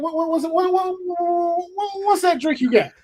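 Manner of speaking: high stammering uncle, stuck on "what"; stuttering uncle. Retold or imitated. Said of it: "And I'm like".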